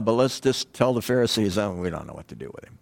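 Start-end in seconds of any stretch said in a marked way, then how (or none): 1.6-2.8 low, soft, fast excuse; sheepish mumble. Retold or imitated. Guards excusing themselves to Pharisees, fast low mumble. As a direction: mumble it quickly, lower and softer.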